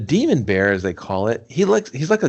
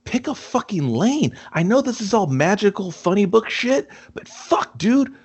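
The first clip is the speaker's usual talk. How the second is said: exasperated rant; 9 semitones higher than usual. Real, not acted. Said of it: "ranting at the movie".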